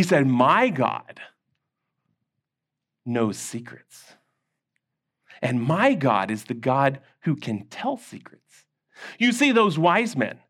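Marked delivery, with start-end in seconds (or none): 0.3-8.7 reverent Daniel. Acted. Daniel's reverent faith statement.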